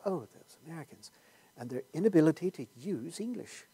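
lightly put-on British accent, sniffy and soft; a hint of a condescending Brit tutting at Americans; mock British chuckle. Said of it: "they'll chuckle".

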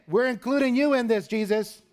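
high, loud desperate plea; frantic, accusing shout, pitched high; panicked disciples. Acted. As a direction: cry out in alarm to wake Jesus. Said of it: "what were they saying?".

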